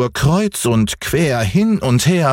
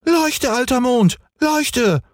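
Häwelmann crying out. A narrator's reading. Boy in the dark ordering the moon, high childish cry. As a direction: call to the moon in a piping voice. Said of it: "schrie Häwelmann".

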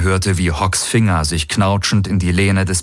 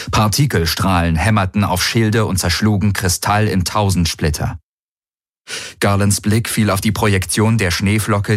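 ordinary talk, nothing marked

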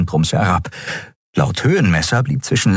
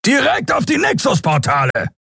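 high, far, forceful shout; captain roaring at his bridge crew. Acted. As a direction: bark the report like a furious hunter.

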